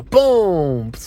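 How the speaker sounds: loud, high "Boom!" gunshot sound; mock gunshot. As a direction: punch out a gunshot noise. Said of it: "and the guy go".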